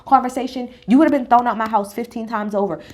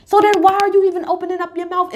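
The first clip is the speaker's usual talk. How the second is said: higher, louder exasperation; exasperated outburst; 8 semitones higher, 4 dB louder than usual. Real, not acted. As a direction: fire the question back in disbelief. Own exasperated reaction.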